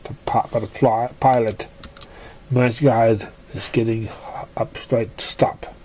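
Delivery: ordinary delivery, nothing marked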